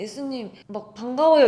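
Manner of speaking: loud, put-on delighted greeting; fake cheer. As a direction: overplay the joy to hide fear. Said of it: "되게 막 가식적으로". (억지로 반가운 척하는 큰 목소리)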